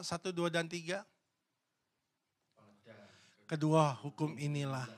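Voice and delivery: ordinary talk, nothing marked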